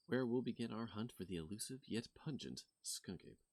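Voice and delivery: soft, hushed documentary host voice; vlog host intro. Acted. Cryptid vlogger opening her video to viewers, hushed. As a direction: narrate like a mystery show host.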